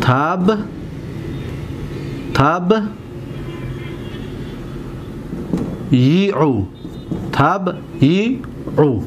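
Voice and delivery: تب and یعو as separate slow pieces; syllable by syllable. Shown as a demonstration. Teacher dissecting the verb for students, syllable by syllable. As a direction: isolate closed تب, pause, then یعو. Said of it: "حرکت را دقت بتید".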